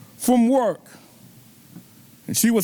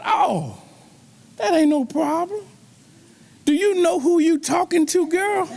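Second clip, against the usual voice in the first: put-on higher, rising brag to his girl; playful dad swagger, pitched up; boastful dad; 6 semitones higher than usual. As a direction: puff up and hype her up. Dad boasting to crying daughter.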